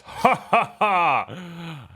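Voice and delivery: slow, high mock laugh "Ha ha ha"; hammed-up villain laugh, drawn out; booming giant laugh. Frost giant scoffs at the little challenger with a slow laugh. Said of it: "And he just laughs".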